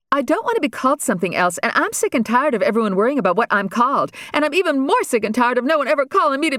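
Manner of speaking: put-on sick-and-tired teenage rant. Daughter complaining to her family, mildly exasperated. Regina's outburst, higher, fast.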